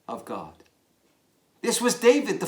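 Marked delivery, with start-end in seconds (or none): 0.1-0.5 hushed reverence. Shown as a demonstration. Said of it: "She meant, of course".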